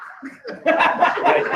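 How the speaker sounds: high burst of laughter. Real, not acted. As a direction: laugh hard at the memory. Laughing at his own story.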